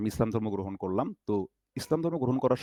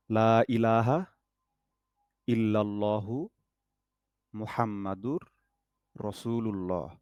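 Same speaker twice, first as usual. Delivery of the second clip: slow, softer recitation for students to follow; 3 dB quieter, slower than usual. Shown as a demonstration. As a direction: recite it slowly so they can mouth along. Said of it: "আমি পাঁচ ছবার পড়তেছি". (ধীরে ধীরে তিলাওয়াত)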